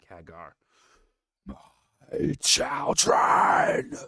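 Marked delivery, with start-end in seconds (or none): 1.4-4.0 very slow, much higher, loud anguished wail; keen for the dead brood; dragon wail. Acted. Deathwing wails over his murdered children. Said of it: "he wailed".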